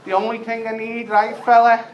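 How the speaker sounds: high, pleading scouse chancer voice; Smackhead Tony. Retold or imitated. Beggar at the door asks for tinfoil, high wheedling voice. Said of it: "he's like".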